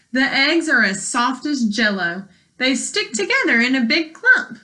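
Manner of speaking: ordinary delivery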